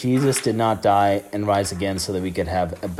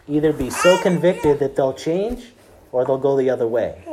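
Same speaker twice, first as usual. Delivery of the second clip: loud, rising preacher's build, higher in pitch; full-force preaching; 4 semitones higher, 5 dB louder than usual. Acted. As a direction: drive each promise home louder and louder.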